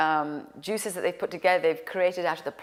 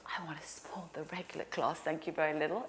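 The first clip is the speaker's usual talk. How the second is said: timid whisper; 2 semitones lower, 9 dB quieter than usual. Retold or imitated. Timid ayahuasca order.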